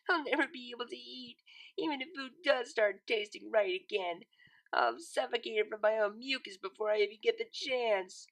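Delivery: wallow in every ache; melodramatic whine. A narrator's reading. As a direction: Lyra whining on the couch about her cold. Sick Lyra complains melodramatically.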